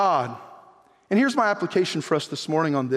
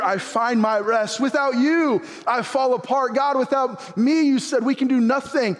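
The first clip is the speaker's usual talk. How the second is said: rising preacher's cadence, higher; preaching; 6 semitones higher than usual. Acted. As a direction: preach it out to the congregation.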